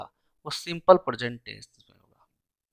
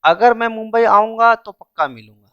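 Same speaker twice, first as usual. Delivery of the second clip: higher and louder "अगर मैं मुंबई आऊँगा"; raised voice to mark the error; mock wrong version; 6 semitones higher, 6 dB louder than usual. Shown as a demonstration. Teacher loudly shows students the technically wrong form. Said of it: "तो technically ये गलत है".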